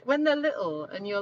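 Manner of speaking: usual speaking voice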